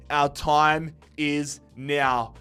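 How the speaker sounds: slow, weighty and deliberate; drawn out like a hype line; slow dramatic announcement. Acted. Slow dramatic repeat of intro.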